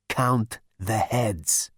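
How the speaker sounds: very slow, low rasping voice. Acted. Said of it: "said Zaphod in a low rasp".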